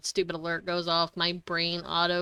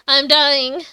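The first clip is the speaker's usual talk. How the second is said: mock dramatic lament; 4 semitones higher, 8 dB louder than usual. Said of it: "I was gonna go".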